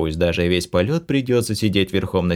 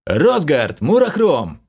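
loud call across a crowd; 8 semitones higher, 4 dB louder, slower than usual. A narrator's reading. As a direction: shout the names to find them. Calling to friends in crowd.

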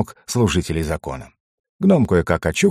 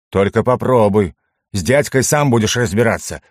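ordinary delivery

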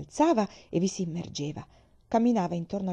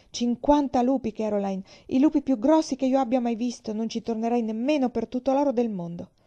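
shaken excitement; 5 semitones higher, 5 dB louder than usual. Acted. Father breathless after wolf chase.

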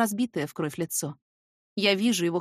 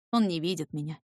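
ordinary delivery

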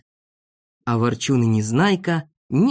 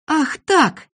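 high, louder indignant outburst; pitched-up exclamation, taking offence; indignant cry; 13 semitones higher, 3 dB louder than usual. Acted. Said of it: "закричал Незнайка".

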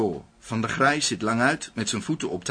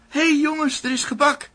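excited call. Acted. Calling the team about cake.

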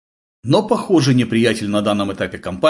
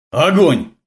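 loud, raised battle order; shouted command; 5 semitones higher, 5 dB louder than usual. A narrator's reading. Gleb orders comrades to fire, shouting. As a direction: bark the order out. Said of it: "закричал Глеб".

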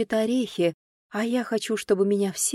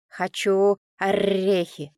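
demanding parrot; slower than usual. A narrator's reading.

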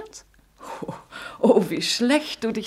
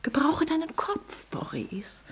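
urgent whisper; 5 semitones higher, 6 dB quieter, slower than usual. Acted. Mother urges her lover.

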